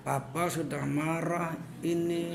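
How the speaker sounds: imitated slow, soft warning; quiet anger, teeth clenched. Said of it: "giginya itu rapat". (menirukan marah pelan dengan gigi rapat)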